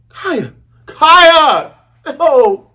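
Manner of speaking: cries out the name, rising to a shout; a wail of grief for Kaya; mock heartbroken calling; anguished cry.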